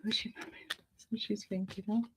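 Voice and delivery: very soft, private murmur; barely audible whisper to yourself; whispered question. Real, not acted. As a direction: whisper it under your breath.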